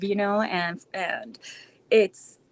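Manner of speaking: normal talking voice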